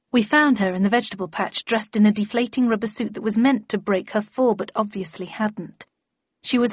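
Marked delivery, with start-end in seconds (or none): none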